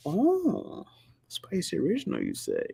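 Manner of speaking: high airy 'oh', dropping at the end; mock intrigued customer. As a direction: play at being intrigued by the offer. Acting out a flavor exchange.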